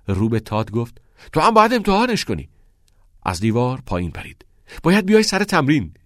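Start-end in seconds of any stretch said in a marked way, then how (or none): 1.3-5.9 eager. A narrator's reading.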